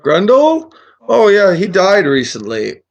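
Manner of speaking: put-on high, surprised old wizard; loud squeak of recognition, then breezy; loopy friendly wizard. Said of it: "a little loopy friendly".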